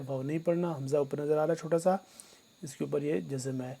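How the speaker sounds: ordinary talk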